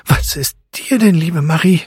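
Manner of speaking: high, alarmed question; startled mother. A narrator's reading. Mother asks Marie what is wrong, startled and high. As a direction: ask in sudden worry, voice lifted. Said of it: "Die Mutter fragte ganz erschrocken".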